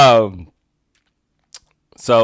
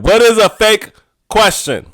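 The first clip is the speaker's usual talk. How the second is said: emphatic; 7 semitones higher, 4 dB louder than usual. Shown as a demonstration. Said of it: "I gotta say it like that".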